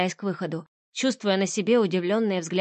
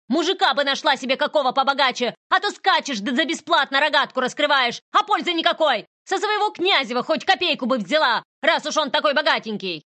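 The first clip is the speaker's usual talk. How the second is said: higher, louder mocking abuse; contemptuous rant; 7 semitones higher, 3 dB louder than usual. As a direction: sneer and scold her without pause. Boss keeps yelling insults. (презрительная брань)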